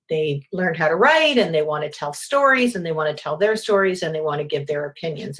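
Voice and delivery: usual speaking voice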